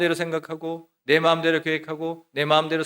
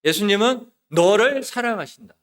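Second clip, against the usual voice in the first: louder, higher climactic declaration; proclamation; 5 semitones higher, 5 dB louder than usual. Retold or imitated. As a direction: lift the voice and proclaim it with force. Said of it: "이런 말씀을 선포했어요". (크고 높게 힘주어 선포)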